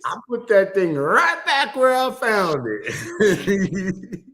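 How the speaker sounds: high, loud, stretched-out mock Southern drawl; imitated southern accent. Co-host quoting the clip with laughter, hammed-up southern drawl. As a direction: swoop through it like a sassy Southern storyteller. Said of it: "Her southern accent too".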